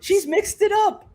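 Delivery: very high, slow exclamation; mock excited coach. Co-host mimicking the coach's commentary, high excited cry. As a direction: stretch it out in a squealed mock cry.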